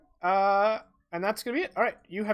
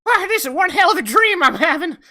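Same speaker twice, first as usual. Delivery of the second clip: high, loud, whooping character voice; Flip in amazement; 10 semitones higher, 10 dB louder than usual. Acted. Game master as Flip amid the chaos, loud amazement. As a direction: exclaim it much higher and louder, wildly amazed. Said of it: "He's like".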